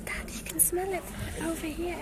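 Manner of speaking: hushed whisper to her dad. Real, not acted. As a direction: keep it to a whisper.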